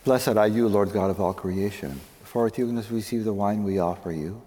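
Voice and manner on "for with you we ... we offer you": usual speaking voice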